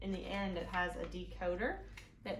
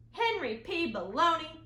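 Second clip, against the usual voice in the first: higher, louder stern teacher; 8 semitones higher, 9 dB louder than usual. Acted. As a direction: call out the boy's full name. Teacher scolds Henry.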